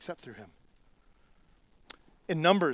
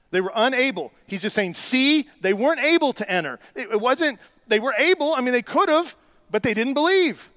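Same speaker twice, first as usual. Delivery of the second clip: much higher and louder, pressing the crowd; preaching at full force; 9 semitones higher, 8 dB louder than usual. Acted. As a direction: rail against grumbling at full volume.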